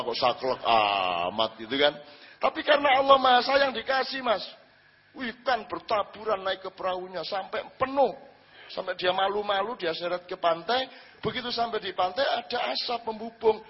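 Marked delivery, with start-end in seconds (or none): none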